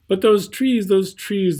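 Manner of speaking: swelling with longing; longing emphasis. A narrator's reading. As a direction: reach for the trees with wonder. Narrator longing for trees.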